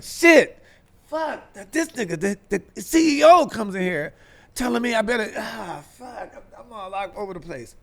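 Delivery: high, frantic swearing to himself; rattled nerves, fading to a mutter; panicked. Retold or imitated. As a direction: freak out about the CEO's visit. Panicking alone in dressing room.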